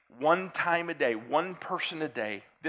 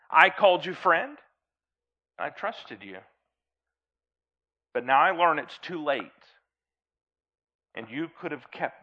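poem recitation; 3 dB louder than usual. Acted. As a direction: recite it gravely, line by line.

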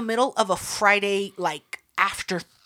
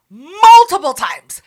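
higher, much louder, slow multiple times; slow loud exasperated stress; exasperated emphasis; 6 semitones higher, 12 dB louder, slower than usual. Real, not acted. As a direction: hammer the complaint home. Emphatic complaint about brother.

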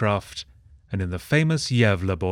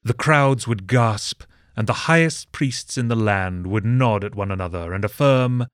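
ordinary delivery

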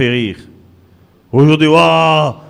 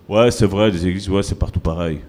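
mocking agreement; 9 semitones lower, 4 dB quieter than usual. Mock agreeing, low and quiet.

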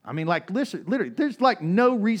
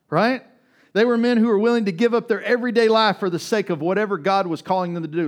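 higher, louder, pressing preacher's build; full-force preaching; 7 semitones higher, 7 dB louder than usual. Acted. Pastor rallies his church about the apostles, full-force preaching. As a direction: drive it home to the congregation.

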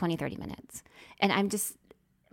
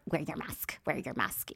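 lower, softer nagging; mock nag; 5 semitones lower, 7 dB quieter than usual. Imitating a bossy passenger, mock nagging. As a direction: nag like a busybody. Mocking a mask hole.

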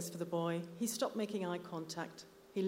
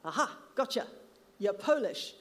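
loud, higher crowing; triumphant gloating; 6 semitones higher, 7 dB louder than usual. Acted. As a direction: crow over catching him out. Eric triumphs over the boy.

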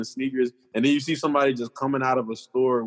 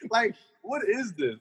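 disbelief; 8 semitones higher, 4 dB quieter than usual. Retold or imitated. Own reaction to cannibal rats.

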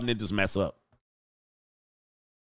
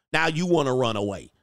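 usual speaking voice

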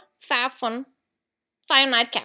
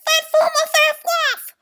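high, loud mock scream of a panicked dog; imitated scream; 8 dB louder than usual. Owner imitating the dog screaming at cones, high mock scream. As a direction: screech it like a dog gone mental at traffic cones. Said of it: "He kept screaming".

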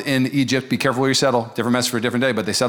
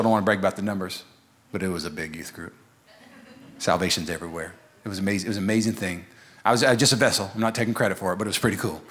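much softer, lower, false-modest aside; soft humble-brag aside to the church; hushed modesty; 8 semitones lower, 7 dB quieter, faster than usual. Real, not acted. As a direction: play it down under your breath.

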